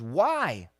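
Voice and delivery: impassioned preached outcry; anguished cry. Acted. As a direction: cry out as the Lord calling with no answer. God's cry, repeated.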